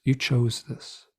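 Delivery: slow, measured beings' voice; calm slow beings answering; slow otherworldly beings. Retold or imitated. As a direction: say it slow and unmoved, like an alien guide. Beings' slow cryptic reply.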